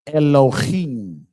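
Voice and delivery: slow repeated demo of "Elohim"; the oppressor's reading again. Retold or imitated. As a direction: repeat "Elohim" slowly, clearly. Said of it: "Let me say it one more time".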